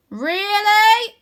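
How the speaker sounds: put-on high, loud skeptical girl; loud sceptical sister voice; doubtful Rose. A narrator's reading. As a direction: scoff in disbelief.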